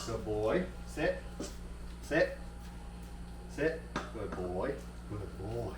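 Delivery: quiet, gentle words to a pet; soft dog talk. Real, not acted. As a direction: murmur praise to a good dog.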